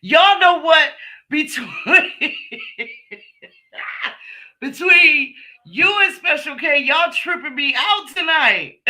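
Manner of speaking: high, loud laughing through words; cracking up at the chat; real laughter. Real, not acted. Host laughing at viewers' jokes, loud real laughter.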